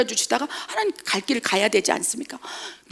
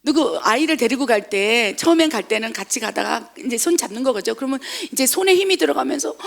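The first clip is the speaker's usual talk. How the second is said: ordinary delivery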